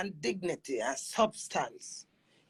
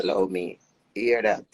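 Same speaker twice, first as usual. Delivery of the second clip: dismissive; 12 semitones lower, 3 dB louder than usual. Real, not acted. Scolding, low and louder.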